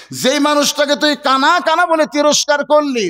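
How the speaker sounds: high-pitched, ringing reproach; indignant confrontation. Acted. Companion confronting shepherd who insulted a great man, indignant. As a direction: demand an answer, stretching the question.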